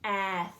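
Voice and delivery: ath with the short a brought out; vowel sound stretched. Shown as a demonstration. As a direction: make the a in ath heard. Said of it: "Let's listen for that vowel sound".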